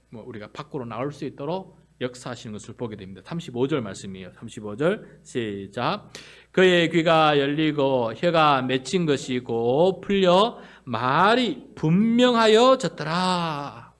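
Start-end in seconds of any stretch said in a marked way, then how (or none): 6.5-13.9 loud, slow, measured verse reading; scripture recitation. Real, not acted. Preacher leading the reading of verse thirty-five, loud recitation. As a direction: lead the congregation through the verse, drawing out each phrase.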